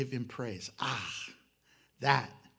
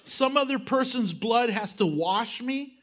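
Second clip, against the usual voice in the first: mocking atheist voice; 6 semitones higher, 4 dB louder, slower than usual. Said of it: "the unbelieving world, when, before I was saved".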